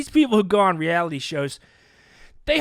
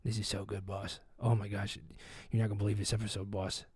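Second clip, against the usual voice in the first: low, very soft producer voice; hushed, low report down the phone; overheard phone call; 9 semitones lower, 16 dB quieter than usual. Retold or imitated. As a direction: murmur thrilled news secretly to the boss. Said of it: "I hear the phone call".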